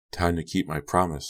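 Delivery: usual speaking voice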